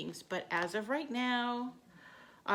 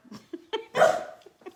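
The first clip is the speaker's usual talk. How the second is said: breathy laugh; 13 semitones higher, 9 dB louder than usual. Real, not acted. Playing with dog.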